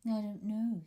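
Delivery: usual speaking voice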